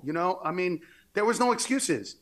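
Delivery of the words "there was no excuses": ordinary talk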